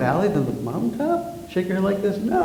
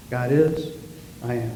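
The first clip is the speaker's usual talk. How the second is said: hushed and lowered, like a prayer; soft mantra; 5 semitones lower, 8 dB quieter than usual. Shown as a demonstration. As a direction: breathe it out gently. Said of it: "to be present in the moment".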